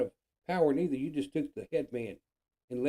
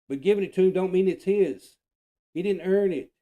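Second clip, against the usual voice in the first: higher and louder, heated; raised, indignant voice insisting he didn't earn it; angry outburst; 6 semitones higher, 10 dB louder than usual. Real, not acted. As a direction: hammer it home in real anger. Angry rant peaks about the prize.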